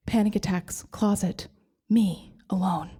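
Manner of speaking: whispered and slow; a lonely whisper; hushed. Retold or imitated. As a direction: breathe it out bleakly. Bleak list of panic attack.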